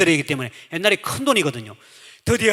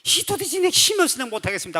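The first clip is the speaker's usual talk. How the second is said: lament; 6 semitones higher than usual. Retold or imitated. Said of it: "통곡하며".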